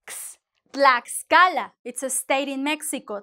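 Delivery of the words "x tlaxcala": a voiceless "ks", then "Tlaxcala" slow and emphatic; louder, slower "Tlaxcala" with clear KS. Shown as a demonstration. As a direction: isolate the KS sound, then place it in "Tlaxcala". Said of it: "And it's the same".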